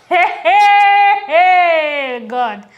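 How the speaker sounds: loud, high drawn-out "hey" falling away; laughing wail to cover tears. Real, not acted. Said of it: "I'm using laugh to cover it".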